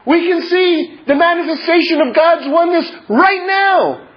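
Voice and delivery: high, emphatic sermon delivery; fervent, ringing exhortation; impassioned preaching. Real, not acted. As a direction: drive the point home to a congregation, pitch held well up. Preaches the lesson with force.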